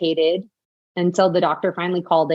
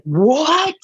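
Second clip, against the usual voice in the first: high startled what; a stunned, rising what; shocked; 8 semitones higher than usual. Retold or imitated. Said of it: "he's like".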